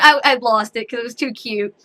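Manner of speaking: ordinary delivery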